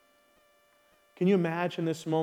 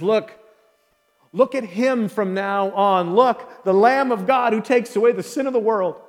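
louder, raised proclamation, pointing the crowd to him; John the Baptist crying out; 4 semitones higher, 6 dB louder than usual. Retold or imitated. Prophet calling to the crowd, lifted and louder. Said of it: "he says".

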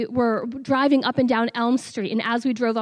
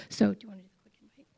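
quick, fast whisper to her husband; hurried whispered cue off-mic; whispered aside; 3 semitones lower, 4 dB quieter, much faster than usual. Real, not acted. Aside to husband during talk.

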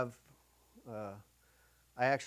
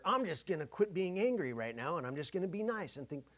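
higher, hammed-up promise to be nice; mock cheerful resolve; 5 semitones higher than usual. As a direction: parody a naive good intention. Mock resolve, parodied self-talk.

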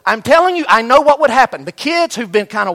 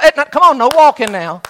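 loud, high shout across the field; coach calling out; 5 semitones higher, 8 dB louder than usual. Retold or imitated. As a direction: push the walkers to run. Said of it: "and I'm like".

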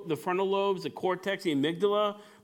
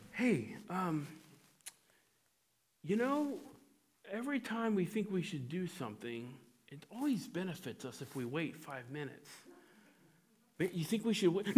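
much softer, calm suggestion to wait; reasonable frontal lobe; 2 semitones higher, 9 dB quieter than usual. Acted. Frontal lobe calmly suggesting a five-minute wait, soft and reasonable. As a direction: gently talk sense for once. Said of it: "the frontal lobe goes".

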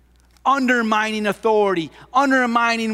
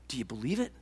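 soft appeal; 9 semitones lower, 13 dB quieter, faster than usual. Acted.

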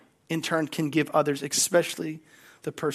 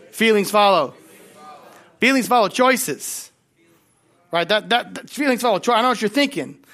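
louder, higher sermon voice; full-force preaching; 6 semitones higher, 7 dB louder than usual. Acted. Pastor urging the congregation to commit, preaching loud. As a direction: preach it out to the room.

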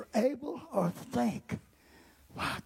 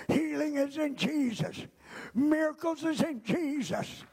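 ordinary talk, nothing marked

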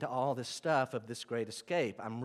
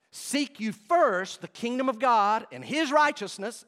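slow, raised, higher and louder; proclaiming scripture; 6 semitones higher, 6 dB louder, slower than usual. Acted. Preacher quoting Jesus to the church, slow proclaiming. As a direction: declare it like Jesus on the mount. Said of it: "Jesus in the Sermon on the Mount said".

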